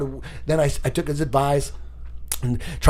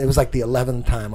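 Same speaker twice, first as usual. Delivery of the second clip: usual speaking voice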